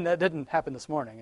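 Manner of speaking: usual speaking voice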